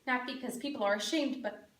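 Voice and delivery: soft, low, with heavy pauses; acted grief, barely getting it out; restrained grief. Mother admitting her son passed on, quietly grieving. Mother tells of son's death.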